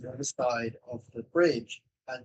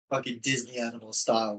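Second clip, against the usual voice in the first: usual speaking voice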